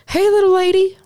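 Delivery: high, loud patronizing greeting; patronizing cop. Retold or imitated. Cop greeting the pulled-over woman, in a high patronizing voice. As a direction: talk down to her sweetly. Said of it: "He's like".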